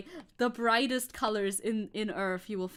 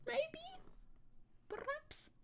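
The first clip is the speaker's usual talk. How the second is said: teasing high squeak; 12 dB quieter, much slower than usual. Pretend. Teasing suggestion to viewer.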